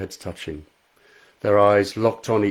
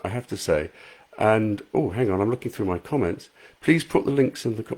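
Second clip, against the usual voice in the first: plain reading voice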